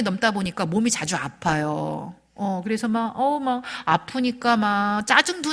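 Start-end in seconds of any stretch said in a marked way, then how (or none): none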